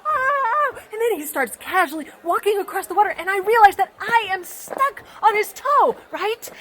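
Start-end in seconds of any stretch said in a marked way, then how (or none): 0.1-0.8 high, loud cry of pain; hammed-up ow; pained yelp. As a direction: yelp as you get stepped on. Droplet being stepped on.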